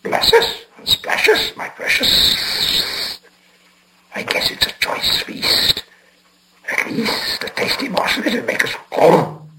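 breathy hissing creature voice, slow on precious; hissing whisper. A narrator's reading. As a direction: whisper and hiss like a hungry cave creature. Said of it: "whispered and hissed".

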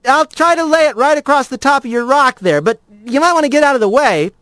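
a confident rat warning. A narrator's reading. Rat warns lizard.

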